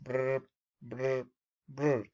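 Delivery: trilled imitation of a ringing phone; brr-ing like a ringtone. Shown as a demonstration. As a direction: mimic three phone rings, spaced apart. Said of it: "¿A qué se refiere con el tercer tono?".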